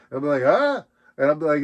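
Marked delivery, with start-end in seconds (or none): 0.4-0.8 loud, high surprised grunt; a startled old man's huh. Retold or imitated. Old comedian startled by being picked, surprised huh.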